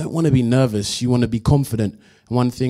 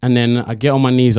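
ordinary delivery

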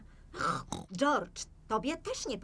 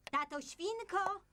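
high, slow, softer call; drawn-out call; 9 semitones higher, 5 dB quieter, slower than usual. Acted. Mother calls father a second time.

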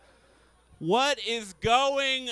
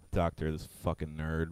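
normal talking voice

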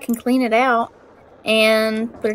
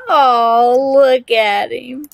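slow, tender gush over a pet; sympathetic aww; 7 dB louder, much slower than usual. Real, not acted. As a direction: melt over something cute. Cat eating, owner gushing.

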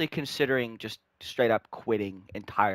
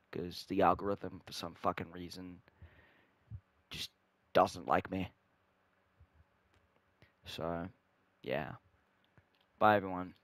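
low, soft, deflated sign-off; deflated mumble; 6 semitones lower, 11 dB quieter than usual. Real, not acted. Vlogger expecting hardly any views signs off, deflated. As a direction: trail away quietly, low and resigned.

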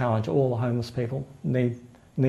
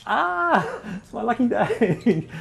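laughing; 8 semitones higher, much slower than usual. Real, not acted. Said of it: "laugh heard".

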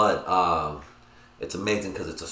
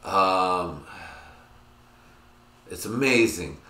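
ordinary talk, nothing marked

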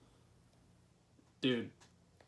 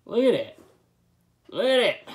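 excited; 10 semitones higher, 12 dB louder than usual. Real, not acted. Showing off juicy dip.

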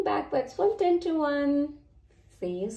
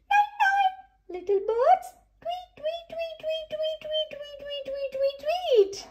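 birdlike goodnight. A narrator's reading. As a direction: chirp goodnight like a tiny bird. Saying goodnight to birds.

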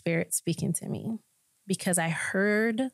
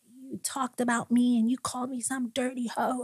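higher, accusing tone; confrontational; 5 semitones higher than usual. Retold or imitated. Confronting her youth pastor, mild higher accusation. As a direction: square up to him. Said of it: "ready to tear him a new one".

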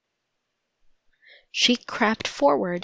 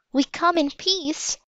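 put-on high little-girl voice; 8 semitones higher than usual. A narrator's reading. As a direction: pitch it well up, as a surrendering child. Little girl surrendering to soldiers.

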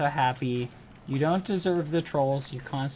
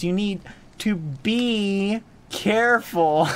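"be" drawn out high and loud; slow, loud, set-apart "be careful"; punning stress on be; 6 semitones higher, 5 dB louder, slower than usual. Shown as a demonstration. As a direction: stretch "be" slowly to land the pun. Makes a bee pun.

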